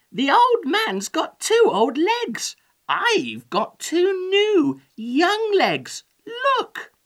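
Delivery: high, loud cheeky character voice; gleeful boasting. A narrator's reading. Gingerbread man mocks the old man's legs, boasting gleefully. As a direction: boast like a cocky little runaway. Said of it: "laughed the gingerbread man".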